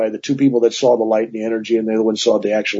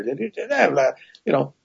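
ordinary talk, nothing marked

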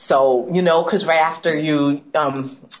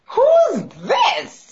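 mock shock. Friend reacting to her calm boyfriend, squealing shock. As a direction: cry out as if you don't recognise him.

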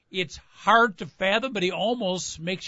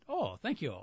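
pleased guest; 2 semitones lower, 10 dB quieter than usual. Retold or imitated. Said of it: "fancy hotel".

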